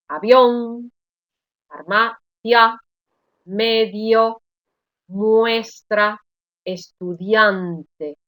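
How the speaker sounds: slow, separate words with the open vowel stressed in "Avión, farmacia, medio, muestra, estudiante"; diphthongs read out. Shown as a demonstration. Teacher reading diphthongs to students, slow word-list demonstration of "Avión, farmacia, medio, muestra, estudiante". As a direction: dictate "Avión, farmacia, medio, muestra, estudiante", each vowel pair kept in one syllable. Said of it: "os voy a leer todos los diptongos".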